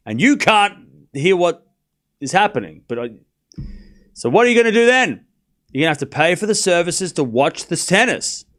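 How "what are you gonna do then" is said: raised, fast mock protest; hammed-up outrage; mock indignant. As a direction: act indignant, voice up high and loud.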